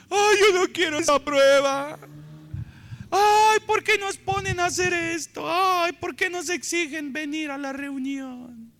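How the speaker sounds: high, loud, whiny wail; mock whining. Preacher mocking a complaining congregant, high whiny wail. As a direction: ham up a weepy complaint. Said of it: "¿Por qué lloró?".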